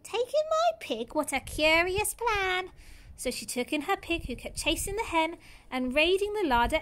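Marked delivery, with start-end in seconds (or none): 0.0-2.7 much higher, slow surprised squeak; astonished old lady. Acted.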